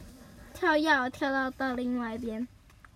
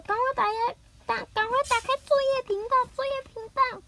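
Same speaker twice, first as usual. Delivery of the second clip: much higher, fast and eager; hurried urging; 10 semitones higher, faster than usual. Acted. Nobita urging Doraemon to switch channels, high and fast. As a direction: hurry him along, all in a rush.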